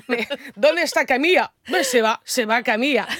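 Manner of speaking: lively put-on Spanish accent, playing Lola. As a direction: become Lola from Zaragoza, Spanish all through. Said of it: "je suis Lola de Saragossa". (accent espagnol exagéré, en Lola)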